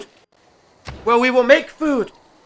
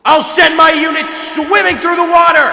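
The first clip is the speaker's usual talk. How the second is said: shouting; 5 semitones higher, 4 dB louder than usual. Acted. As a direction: bark the attack order. Declaring attack in game.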